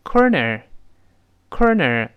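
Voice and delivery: American "corner" with both r's curled; slow American "corner", r in each syllable; American pronunciation. Shown as a demonstration. Teacher contrasting it with British for students, American pronunciation. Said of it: "而美式发音呢，跟这个类似".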